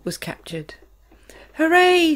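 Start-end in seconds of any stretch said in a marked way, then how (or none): 1.6-2.2 loud, high cheer. A narrator's reading. Grasshopper cheers the seagull's capture, loud and high. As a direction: cheer in triumph. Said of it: "shouted the old green grasshopper".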